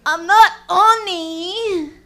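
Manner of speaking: deadpan offer, stretched out slowly; naked friend's offer. Retold or imitated.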